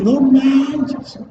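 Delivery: todavía más agudo, "no manches" exclamado con la boca muy abierta; boca más abierta aún. Shown as a demonstration. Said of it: "Abrimos bien". ("no manches" exclamado muy agudo, con la boca muy abierta)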